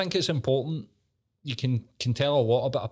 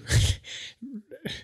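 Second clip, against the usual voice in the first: high laugh; 14 semitones higher than usual. Real, not acted. Laughs at his own question.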